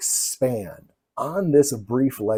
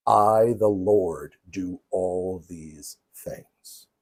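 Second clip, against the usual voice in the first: solemn scripture reading; 4 semitones lower, slower than usual. Acted. Lecturer quoting God's own words, solemn and slow. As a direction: read it gravely, letting each phrase settle.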